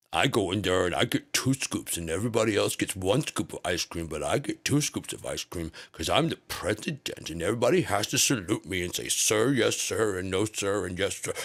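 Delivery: mock whiny kid-president voice; lightly voiced spoiled child; childish president. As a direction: play him like a bragging four-year-old. Mocking Trump as a childish president.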